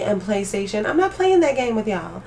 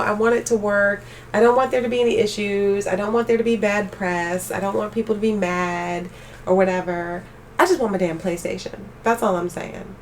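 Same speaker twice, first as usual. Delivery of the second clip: normal talking voice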